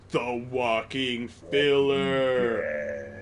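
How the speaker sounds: slow booming segment title; dramatic announcer. Acted.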